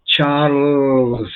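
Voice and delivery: Charles with a silent E, English style; pronunciation teacher models Charles in English. Shown as a demonstration. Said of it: "usted tiene que decir, a ver".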